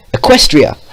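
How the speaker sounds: loud sudden realization; loud exclamation. Acted. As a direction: blurt it out loudly. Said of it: "Vio said loudly".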